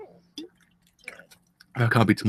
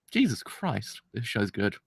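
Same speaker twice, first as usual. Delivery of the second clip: awed hush; 5 semitones lower, 10 dB quieter, slower than usual. Real, not acted. Awed hush after twist.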